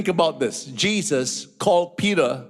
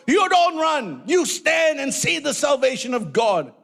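full-force preaching; 11 semitones higher than usual. Acted. Preacher urging shepherds not to run, shouting. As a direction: thunder it at the congregation.

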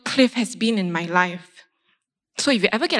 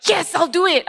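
bright, higher willing reply; mock eager; 7 semitones higher than usual. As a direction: play the keen helper, lightly. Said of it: "I wasn't like".

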